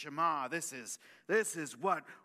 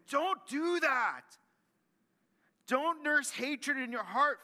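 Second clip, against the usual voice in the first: scolding mom voice; 8 semitones higher, 5 dB louder than usual. Retold or imitated. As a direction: nag like a fed-up mom. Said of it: "I can't do it with a mom voice".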